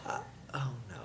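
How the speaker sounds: soft whispered "uh, oh no"; mock dread whisper. Realizing a map fact to share, whispered in mock dread. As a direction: breathe it like a guilty little realization. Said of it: "And I go".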